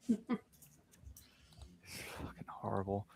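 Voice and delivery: breathy unvoiced whisper of disgust; whispered curse. Real, not acted. Reacting to bad comic art, whispered disgust. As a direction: hiss it under the breath.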